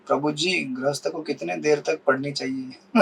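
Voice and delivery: lightly voiced asker, low and hushed; slow, soft, low imitation of someone asking; mock devotee's question.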